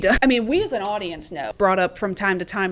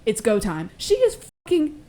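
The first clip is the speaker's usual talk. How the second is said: ordinary delivery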